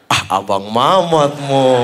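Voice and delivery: slow, low, drawn-out name; stretched-out low teasing recognition; insisting fan. Retold or imitated. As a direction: imitate a knowing fan, dragging the words.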